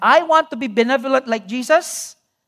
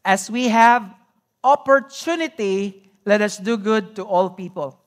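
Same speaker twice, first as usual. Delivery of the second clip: reading scripture aloud together; 4 dB louder than usual. Real, not acted. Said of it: "I want us to read this together".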